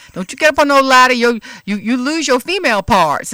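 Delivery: loud, fast Gullah warning; Gullah accent. Retold or imitated. Tootsie warning the girls in a loud Gullah accent. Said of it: "she was low country Gullah".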